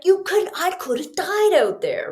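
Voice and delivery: high, indignant outburst; laying into her after the scare; scolding Shelly. Retold or imitated. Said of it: "do I give it to Shelly".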